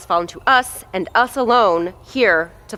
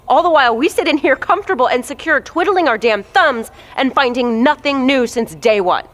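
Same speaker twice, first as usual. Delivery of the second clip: impassioned outburst; 6 semitones higher, 5 dB louder than usual. Acted. Impassioned plea to the team.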